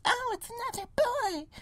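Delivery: squeaky mock voice of a money-hungry doctor; greedy doctor parody. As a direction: cackle like a greedy villain. Mocking greedy clinic doctor.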